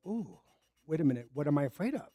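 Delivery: soft, inward questioning; hushed realisation. Retold or imitated. Tenant questioning own fear, hushed and reflective. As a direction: wonder quietly to yourself.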